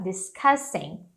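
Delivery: Discussing drawn out slowly, weight on CUSS; slow it down and press the CUSS; stress on CUSS, slowly. Shown as a demonstration. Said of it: "A mais forte". (Discussing devagar, força no K)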